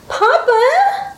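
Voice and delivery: put-on very high, pleading little-girl call; high-pitched rising plea; begging child. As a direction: beg for father in a childlike voice. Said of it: "the woman-child begged behind him".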